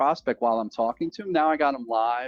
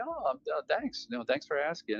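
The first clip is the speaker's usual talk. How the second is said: softer, much higher, light and grateful; friendly caller; 6 semitones higher, 7 dB quieter than usual. Retold or imitated. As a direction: thank them like you're glad they asked. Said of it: "I'll say".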